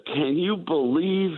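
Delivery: high, slow mock disbelief; incredulous writer. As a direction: act out the writer's shock at the salary. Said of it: "one of the writers said".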